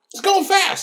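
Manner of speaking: high, awed little squeal; mock-excited high squeal. Host gushing about the freeway stunt. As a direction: squeal it like an amazed kid.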